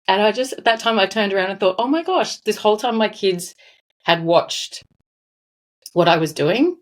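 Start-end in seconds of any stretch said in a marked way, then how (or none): 1.8-6.8 real emotion welling up mid-story; moved, voice catching on the memory; getting emotional. Real, not acted. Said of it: "Sorry, I'm getting a bit emotional".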